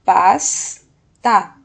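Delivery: PASTA as a loud PAS, pause, TA; two separate, emphatic beats on PASTA; syllable by syllable. Shown as a demonstration. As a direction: break PASTA after a held S. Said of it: "mas o final da sílaba".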